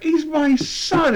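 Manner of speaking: high and slow, a hammed-up grieving father; mock grieving dad. Recapping the show, mimicking the father's slow high plea. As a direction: play the wounded dad, drawn out and high.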